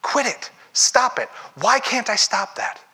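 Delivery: a hint of harsh inner voice; self-scolding. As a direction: tell yourself off, frustrated. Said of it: "the only way we treat ourselves".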